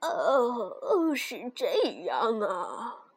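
put-on slow, low old dwarf. Dwarfs hear the girl's whole story, slow dwarf voice. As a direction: draw it out as a little old dwarf. Dwarfs react to her tale.

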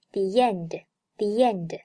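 "the end" said like "thee-yend"; linking y glide. Shown as a demonstration. Teacher repeats for learners, "the end" linked by y. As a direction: put a y sound between "the" and "end". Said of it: "中间夹一个yet的音".

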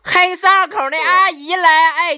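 high, loud Beijing announcer; Beijing accent. Retold or imitated. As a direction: imitate a Beijing station announcement. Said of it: "然后这边就是北京话".

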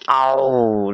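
long drawn-out mock roar; imitated roar. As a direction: draw out a storybook dinosaur howl. Imitated roar while telling the fight.

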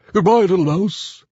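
high, alarmed owl farewell; frightened owl. Acted. Owl flees the Gruffalo, high alarmed voice.

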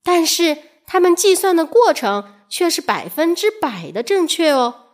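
ordinary delivery, nothing marked

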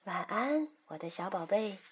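soft, gentle good-night; tender. Acted. Mother tucks in her child, tenderly. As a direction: soothe the child softly to sleep. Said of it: "温柔地说".

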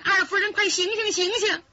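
high urgent calling; shouting to wake. Acted. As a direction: yell into her ear to wake her. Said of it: "扯着耳朵喊".

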